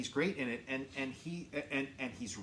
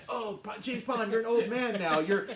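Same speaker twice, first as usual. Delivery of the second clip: loud, high mocking outburst; raised-voice heckle of an ageing hero; mock exasperation; 11 semitones higher, 7 dB louder than usual. Moviegoer heckling an ageing James Bond, mock exasperated. Retold mockery of aging Bond.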